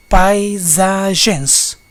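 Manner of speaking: paisagens drawn out as pai-sa-gens; syllable by syllable. Shown as a demonstration. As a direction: stretch each syllable of paisagens slowly. Exemplo de divisão em sílabas. (paisagens, sílaba por sílaba, bem devagar)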